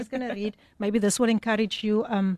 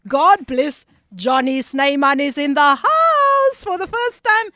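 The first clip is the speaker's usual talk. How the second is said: louder, higher, slow drawn-out DJ call; hype shout-out; 4 semitones higher, 7 dB louder, slower than usual. Acted. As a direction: announce him like a big entrance. Host hyping a first-time listener.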